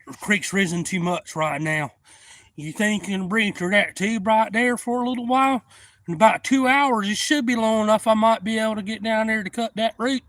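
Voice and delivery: high loud voice. Retold or imitated. Buddy joking from bank.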